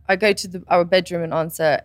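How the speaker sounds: normal talking voice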